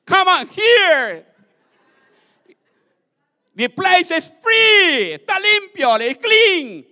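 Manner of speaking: high, long-drawn holler; hammed-up calling out at full volume; demon calling others. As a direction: holler to a far-off gang, stretching the words. Demon calls the others to come.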